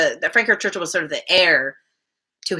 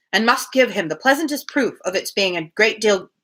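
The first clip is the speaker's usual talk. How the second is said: steady read-aloud of a classic novel; measured literary narration; story narration; 4 semitones higher than usual. Acted. Reads novel aloud between chat.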